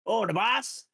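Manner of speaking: put-on loud, high loose-lipped drunk; drunken goon. Drunk goon gives away his boss, loud slurry voice. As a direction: blurt it out like a buttered-up henchman, high and loud. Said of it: "if you revealed Silco and he'd be like".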